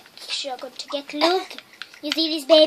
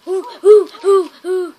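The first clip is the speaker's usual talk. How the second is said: mock labour hoots; 3 semitones higher, 9 dB louder than usual. Player mimicking a Sim in labour, loud mock hoots. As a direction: hoot "ooh" like a pretend birth.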